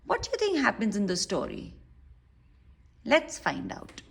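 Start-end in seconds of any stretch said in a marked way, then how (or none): none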